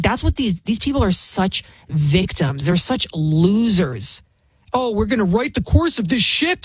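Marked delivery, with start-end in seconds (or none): 4.7-6.6 lightly mocking imitation; mock a TV panelist's pronouncement; mocking quote. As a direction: parrot it back in a put-on voice. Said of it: "Sonny Hostin said".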